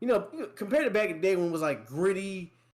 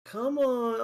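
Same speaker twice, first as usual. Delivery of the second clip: high, insistent mock coaxing; hand-holding game; 7 semitones higher than usual. Game urging the player along, mock coaxing. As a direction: coax like a pushy tutorial, pitched up. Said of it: "hold your hand like they're your girlfriend".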